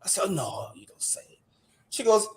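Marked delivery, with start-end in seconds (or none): 0.3-1.4 hushed unvoiced reply; a whispered aside under the breath. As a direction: whisper it so she won't hear. Retold reply to a stranger.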